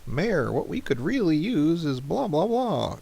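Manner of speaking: a hint of a nagging townsperson; mock citizen. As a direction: play a whiny citizen asking the mayor. Voicing a citizen's request.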